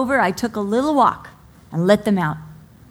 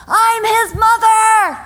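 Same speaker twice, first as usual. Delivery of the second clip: much higher, louder, slow proud shout; 13 semitones higher, 10 dB louder, slower than usual. Pretend. Proud mother shouting to the whole church. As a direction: belt it out proudly. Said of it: "I almost wanna shout out".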